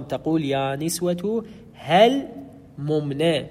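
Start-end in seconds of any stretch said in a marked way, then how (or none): 1.7-3.5 هل ممن slowly, stopping on the l: "hel; vowelless lam. Shown as a demonstration. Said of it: "بسكون اللام".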